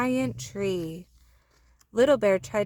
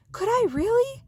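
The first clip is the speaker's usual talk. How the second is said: amazed little bear; 4 dB louder than usual. Acted.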